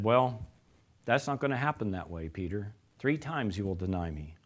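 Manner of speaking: Jesus gently warns Peter; quiet sad warning. Acted. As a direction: tell him softly what will happen. Jesus foretelling Peter's denial.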